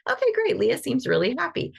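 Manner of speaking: high, easygoing friend voice; friend convinced. Retold or imitated. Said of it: "she's like".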